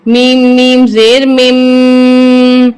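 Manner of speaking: slow drawn-out مم with held nasal ghunna; spelling out Quran letters, مم stretched with ghunna; Quran recitation spelling. Shown as a demonstration. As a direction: recite مم slowly, holding the doubled میم. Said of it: "اس کو سختی کے ساتھ پڑھیں گے".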